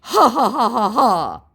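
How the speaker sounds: high, loud taunting ha-ha; a smug fake laugh mocking the victim; mocking scammer laugh. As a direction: cackle like you've just scored a point. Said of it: "like he'd scored some sort of point".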